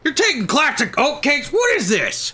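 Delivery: outraged leader, hammed-up; Dutch voice. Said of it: "I think my Dutch voice is a lot better".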